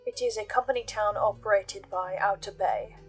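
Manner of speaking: ordinary delivery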